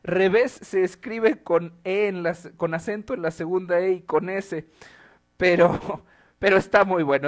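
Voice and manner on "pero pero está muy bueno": riendo. Real, not acted. Laughing while judging the meme.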